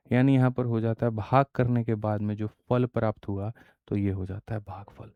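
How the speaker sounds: usual speaking voice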